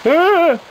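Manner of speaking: sharp high yell of pain; frustrated scream. Real, not acted. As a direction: cry out suddenly in frustration. Hit in a video game.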